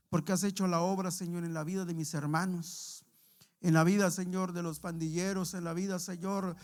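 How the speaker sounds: oración pastoral ferviente y fuerte; oración en voz alta. Acted. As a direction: clamar a Dios ante la congregación. Dirige la oración final. (oración ferviente del pastor que clama por la congregación)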